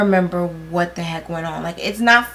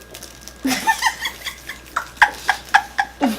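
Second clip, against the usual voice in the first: high squealing laugh. Real, not acted. Friend eating honey bun.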